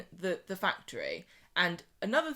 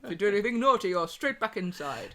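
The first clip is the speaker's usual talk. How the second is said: a hint of a stern official, louder; mock stern boss; 4 dB louder than usual. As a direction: warn her like a strict boss, lightly voiced.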